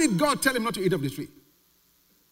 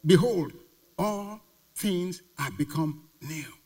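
slow, solemn scripture reading; slower than usual. Acted. Preacher reading the verse to the congregation, slow and solemn. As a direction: read the verse slowly and deliberately.

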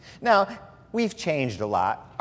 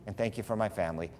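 quick prayer; 5 semitones lower, 6 dB quieter than usual. Shown as a demonstration. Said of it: "Here's how I pray".

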